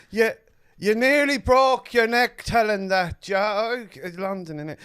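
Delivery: put-on London accent. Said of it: "London accent".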